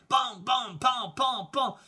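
high, loud staccato whacks; imitated blows. A narrator's reading. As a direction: punch out each hit sharply. Narrator acts out the blows.

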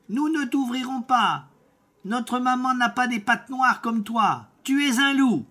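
put-on high, loud children's cry; kids shouting. Goat kids shouting at the wolf through the door, high and loud. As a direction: shout it out like frightened little goats. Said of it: "et crièrent".

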